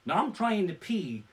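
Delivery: normal talking voice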